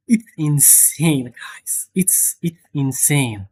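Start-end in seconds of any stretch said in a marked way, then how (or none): 1.2-1.9 whispered. Real, not acted. Hushed aside to viewers. 1.9-3.5 hushed whisper of disbelief; unvoiced awe-struck whisper. Real, not acted. Awed repeat to viewers, hushed.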